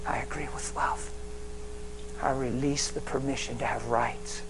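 soft, hushed prayer to the Spirit; quiet prayer. Acted. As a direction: pray it low and intimate. Prayer spoken softly.

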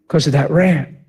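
usual speaking voice

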